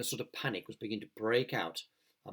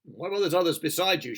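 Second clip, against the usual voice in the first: shouted; 7 semitones higher, 8 dB louder than usual. Acted. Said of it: "shouted the chief of police".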